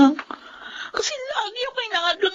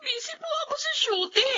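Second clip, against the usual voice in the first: higher, softer, close to tears; tearful excuse; 8 semitones higher, 9 dB quieter than usual. Acted. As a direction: defend yourself while crying. Granddaughter tearful, defending herself. (umiiyak na paliwanag)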